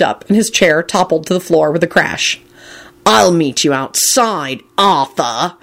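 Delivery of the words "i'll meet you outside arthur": slow, menacing challenge; deathly snarl. A narrator's reading. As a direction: snarl it slowly, deadly serious. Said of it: "he snarled in a deathly tone".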